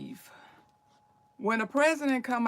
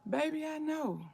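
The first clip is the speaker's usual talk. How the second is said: tearful mother; 5 semitones higher, 6 dB quieter than usual. Acted. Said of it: "got big crocodile tears in your eyes saying".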